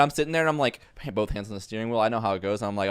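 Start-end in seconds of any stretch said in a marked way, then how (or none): none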